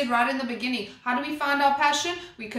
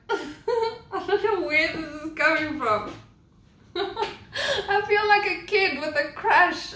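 can't stop the giddy laughter breaking in; giddy giggling; 6 semitones higher than usual. Real, not acted. Tarot reader to viewers, suddenly giggling and giddy. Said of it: "I don't know where this giddy giggling".

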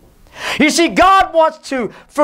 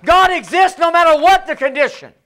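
preaching; 5 semitones higher than usual. Acted. Preacher to congregation, building fervor. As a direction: build it up with conviction.

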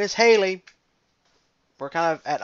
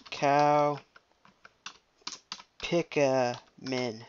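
loud, slow, drawn-out mock retort; defiant moo back; 3 dB louder, much slower than usual. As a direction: fire the moo back at her. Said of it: "And I'd be like".

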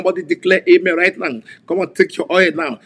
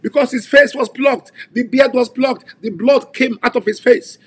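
higher-pitched fervent preaching; impassioned preaching; 7 semitones higher than usual. Acted. Preacher to congregation about Jesus' face, impassioned. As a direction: drive home the suffering.

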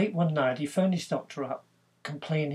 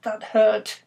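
pained complaint; 6 semitones higher, 11 dB louder than usual. Retold or imitated. Harry complains to the doctor.

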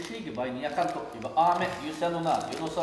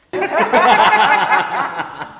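loud laugh; 5 semitones higher, 15 dB louder than usual. Real, not acted. Friends laughing at joke.

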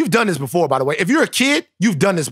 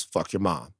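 quoted low and soft; 10 semitones lower, 10 dB quieter than usual. Shown as a demonstration. Host quoting a listener's line, low and soft. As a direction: drop low and quiet on the quote. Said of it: "I didn't say that".

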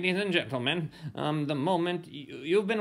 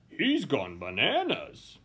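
ordinary delivery

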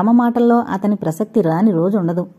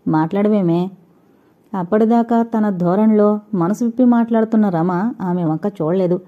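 usual speaking voice

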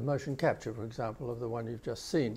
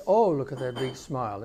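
emphatic OH, then pitch falling away; louder start, melody sinking a little to the end; voice going down; 6 dB louder than usual. Retold or imitated. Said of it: "her voice goes down a little bit".

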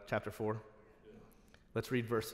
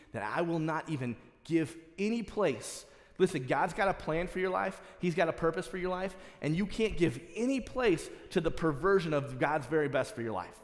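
louder and higher, pressing each point; fiery preaching; 4 semitones higher, 4 dB louder than usual. Acted. Preacher urging the congregation, at full force. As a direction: preach it hard to the crowd.

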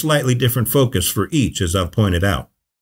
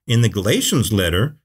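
ordinary talk

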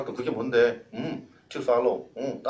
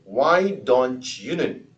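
slow emphasis; much slower than usual. Shown as a demonstration. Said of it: "늘 강조하지만".

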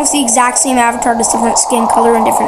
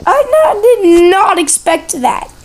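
surprised; 5 semitones higher than usual. Real, not acted. Reacting to tower fall.

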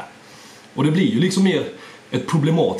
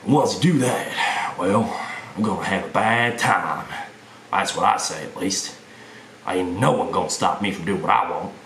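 drawling Southern tough-guy threat; Southern US accent. Shown as a demonstration. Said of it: "that is how the South works".

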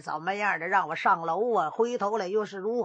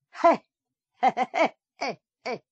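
a cold, clipped heh-heh laugh that fades; mocking dry chuckle; bitter laugh; 3 semitones higher than usual. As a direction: laugh through suppressed rage.

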